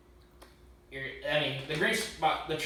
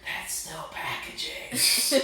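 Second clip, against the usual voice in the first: stage whisper; 2 semitones higher, much slower than usual. Pretend. Co-host redoing the packaging remark as a joke, stage whisper. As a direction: whisper it big and slow for the whole room. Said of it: "should have done a stage whisper".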